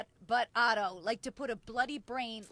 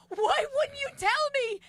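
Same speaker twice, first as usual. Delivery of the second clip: very high, loud mock-indignant wail; exasperated protest; 11 semitones higher, 4 dB louder than usual. Comedian protesting to the brother nobody warned them, exasperated protest. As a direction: throw the blame back, squealing in disbelief.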